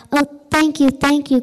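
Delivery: eager, grateful cry of thanks; excited. Acted. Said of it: "cried Amelia".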